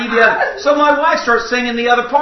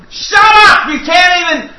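high, loud mock yell; retold yell; 8 semitones higher, 6 dB louder than usual. Preacher retelling yelling at a singer, mock yell. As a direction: snap at him to stop singing. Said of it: "I yelled at the guy singing".

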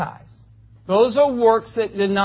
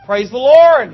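mock praise shout; 3 semitones higher, 9 dB louder than usual. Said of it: "And people will think".

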